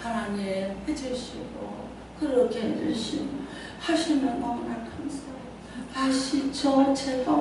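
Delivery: quiet, gentle pleading prayer; soft polite prayer. Retold or imitated. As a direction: pray softly and politely, like asking a favour. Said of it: "무슨 기도를요". (조용하고 공손한 기도)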